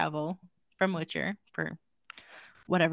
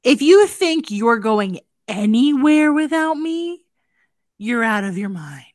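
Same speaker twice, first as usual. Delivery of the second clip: possessive friend; 3 semitones higher, 8 dB louder than usual. Acted. Voicing herself as the friend.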